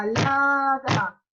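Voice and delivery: "Ensalada" slow, beat by beat; syllable beats. Shown as a demonstration. Teacher to class, counting syllables aloud. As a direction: clap "Ensalada" slowly. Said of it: "¿esto qué es ensalada?".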